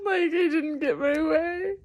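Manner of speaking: high, half-laughing cry on didn't get my way; giggling at her own tantrum while teary; a laugh cracking into tears; laughing through tears. Real, not acted. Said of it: "I'm little [name] and just throwing a tantrum".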